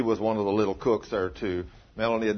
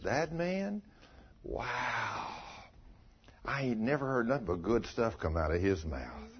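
hushed admiring coworker; 8 dB quieter than usual. Retold or imitated. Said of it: "the way it should be".

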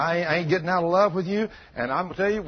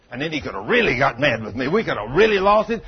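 ordinary talk, nothing marked

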